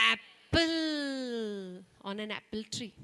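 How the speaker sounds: apple said slowly with a long open "a"; stretched short a. Shown as a demonstration. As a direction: draw out the "a" of apple. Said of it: "we don't say apple".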